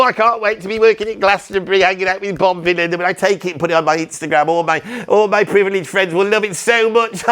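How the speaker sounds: high, eager pleading son; gushing posh son. Acted. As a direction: beg like a spoiled rich kid dreaming of fame. Spoiled son gushes to dad.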